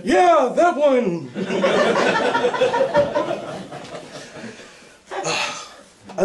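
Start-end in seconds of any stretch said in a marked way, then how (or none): 0.0-1.3 put-on slow, high careless old man; carefree old man. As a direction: shrug it off like a grandpa. Old guy answering carelessly.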